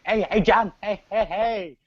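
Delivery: high, loud mock Mexican accent. Expecting Del Rio's accented greeting, mock Mexican accent. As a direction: call out in an exaggerated Mexican accent. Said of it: "I thought he was gonna say it like that".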